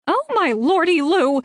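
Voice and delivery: high, loud gossip's cry; shouted exclamation. Acted. Rita shouting in her ear over the phone, high and loud. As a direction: blast it down the phone line. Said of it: "someone shouted in my ear".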